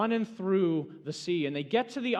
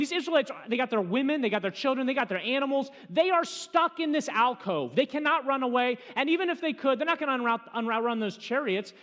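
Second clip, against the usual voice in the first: higher, louder rising urgency; building sermon; 7 semitones higher, 5 dB louder than usual. Acted. Preacher builds the tension for his listeners, urgent and loud. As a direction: drive home how trapped they are.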